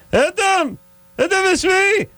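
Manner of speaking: falsetto Israeli neighbor calling up; hammed-up older Israeli woman, piercingly high; horrible Israeli accent. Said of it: "It's a horrible accent".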